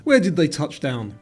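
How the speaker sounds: ordinary delivery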